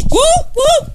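high siren whoop; police siren imitation. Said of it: "That's the sound of the police".

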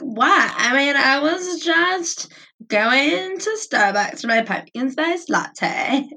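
high, drawn-out mock basic girl; put-on girly coffee-order voice. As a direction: stretch it out like a parody pumpkin-spice girl. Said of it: "I do that voice and Jess hates".

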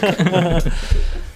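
big laugh at his own pun; corny dad laugh. Real, not acted. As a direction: laugh hard at the corny joke. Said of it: "such a dad joke".

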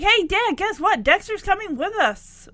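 mock kid yelling for his father; imitated yell. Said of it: "Ricky's yelling for his father".

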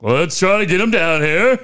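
playing the showman calling a guy down; a hint of a hypnotist. Hypnotist calling a man down from the balcony, mock stage voice. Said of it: "He's like".

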